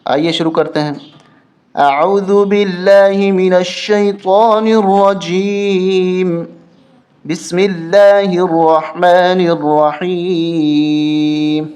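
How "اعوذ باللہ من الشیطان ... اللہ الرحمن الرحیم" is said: slow melodic tajweed chant; Quran recitation. Shown as a demonstration. Teacher opens the lesson with slow recitation. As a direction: draw out each word in recitation.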